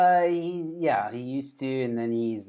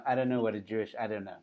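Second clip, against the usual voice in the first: normal talking voice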